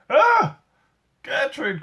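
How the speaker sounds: high loud anguished cry. A narrator's reading. Boy Eric cries out to the scared gorilla, high and loud. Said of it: "cried the boy".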